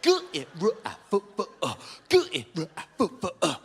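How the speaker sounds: mushed, wobbling letter sounds; garbled letters. Retold or imitated. Assessor spelling over a breaking Zoom line, garbled. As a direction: spell it through a bad connection.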